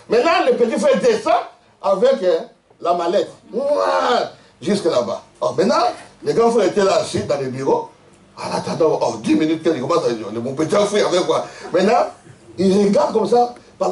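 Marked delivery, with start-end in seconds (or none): none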